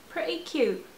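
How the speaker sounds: pretty cute lifted high; high mock echo. Gamer echoing her outfit choice in a high voice.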